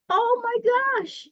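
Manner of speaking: high, slow awed exclamation; amazed gasp. Real, not acted. Reader amazed by the marble palace. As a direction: marvel out loud.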